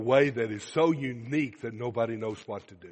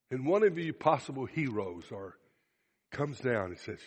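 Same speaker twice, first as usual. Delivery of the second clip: ordinary talk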